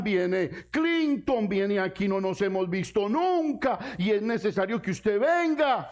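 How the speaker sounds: pleading relative in pulpit voice, slow on the guest list; preaching with a voiced relative. Relative begging the believer to come to a party, pleading. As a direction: plead like an uncle inviting you.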